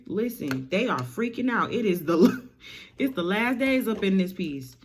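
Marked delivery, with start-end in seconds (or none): none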